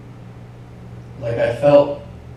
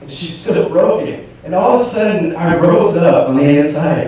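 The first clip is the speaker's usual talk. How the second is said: ordinary delivery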